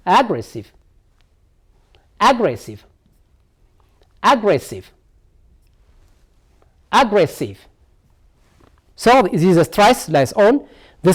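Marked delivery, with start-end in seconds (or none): 0.0-7.6 "Aggressive" repeated evenly, each falling; "Aggressive" as a spaced-out teaching model; stressed-syllable demo. Shown as a demonstration. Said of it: "So the stress lie on here now".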